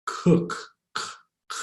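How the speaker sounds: "k" made far back in the throat; short back-of-throat "k" bursts; throaty K. Shown as a demonstration. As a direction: isolate the "k" as if clearing the throat. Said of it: "that k in the back of your throat".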